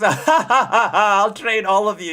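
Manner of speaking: slow, high grand promise with a laugh; hammed-up mentor. Streamer playing Rengoku taking students, hammed-up. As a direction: boom it like a proud mentor. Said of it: "like, that is".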